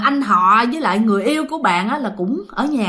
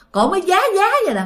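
usual speaking voice

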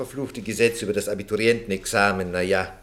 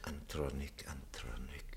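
breathy whisper, trailing off as he searches his memory; whispered musing; 8 semitones lower, 17 dB quieter than usual. Acted. Branesco whispers the name to himself, trying to place it. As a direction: whisper the name to yourself, half remembering. Minister muses over unfamiliar name.

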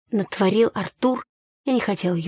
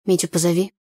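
ordinary delivery

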